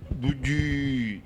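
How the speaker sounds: drawn-out offer. Acted. Host offers the guest a dish, long drawn-out word. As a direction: hold the last vowel of "دجي" very long.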